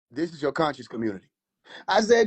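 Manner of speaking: ordinary delivery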